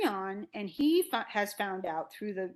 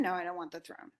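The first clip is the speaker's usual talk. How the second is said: softer, settling into a falling statement; dismissive denial; 3 semitones lower, 7 dB quieter, faster than usual. Retold or imitated. Creon denies wanting the throne, low-key dismissal. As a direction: answer your own question, dropping the pitch.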